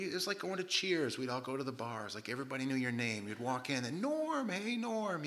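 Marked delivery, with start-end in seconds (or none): 4.0-5.2 high, cheery call across a bar; calling out a greeting. Retold or imitated. Walking into a bar, everybody greets Norm with a high call. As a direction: sing out the name like the whole bar greets him.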